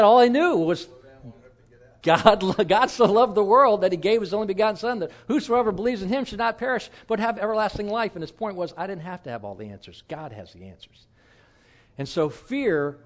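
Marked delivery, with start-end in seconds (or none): 0.0-8.2 louder, bright retelling of the verse with a laugh; joyful quoting. Retold or imitated. New believer quoting John three sixteen to his friend, delighted and louder.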